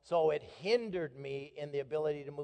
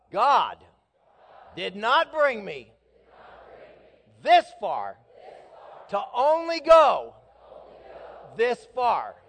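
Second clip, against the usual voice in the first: much higher and louder, word by word; shouted preaching; 7 semitones higher, 12 dB louder, slower than usual. Shown as a demonstration. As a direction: shout each phrase out to the church. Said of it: "Let's try that again together".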